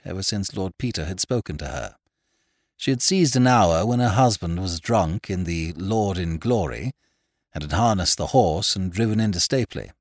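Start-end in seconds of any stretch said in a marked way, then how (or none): none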